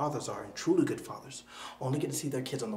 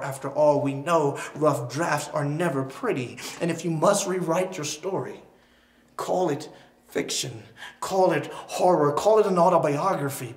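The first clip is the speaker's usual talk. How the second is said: louder with each call, ending in a near shout; rising declamation; 3 semitones higher, 9 dB louder than usual. Acted. Poet urging a hurt listener, building to a loud cry. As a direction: hammer each "call it" harder. Spoken word poem final build.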